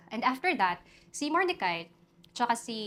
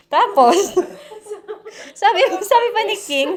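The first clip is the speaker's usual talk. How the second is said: high, loud laugh bursting through the words; can't stop laughing while telling it; 12 semitones higher, 15 dB louder than usual. Real, not acted. Laughing as she tells the class what the king said.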